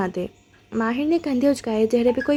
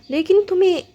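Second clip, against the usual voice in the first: lifted high and louder on تمہیں; pressing emphasis; 6 semitones higher, 4 dB louder than usual. A narrator's reading. Yasmin challenges Mahir, تمہیں lifted high and louder. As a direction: push the question home on تمہیں.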